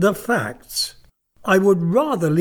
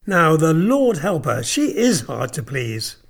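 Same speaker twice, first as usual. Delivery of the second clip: put-on a sneering old lord, louder at the start; 4 semitones higher, 4 dB louder than usual. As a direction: scoff at the girl's stubbornness. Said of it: "sneered the old gentleman".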